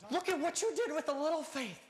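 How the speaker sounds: high, impassioned cheer, sustained at a peak; exultant praise. Acted. Jesus praising Peter for stepping out of the boat, exultant.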